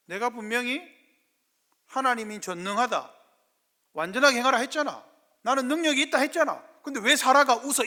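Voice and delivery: God's rebuke. Acted. Preacher voicing God rebuking Abraham over Sarah, building scold. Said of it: "아브라함을 향한 질책입니다".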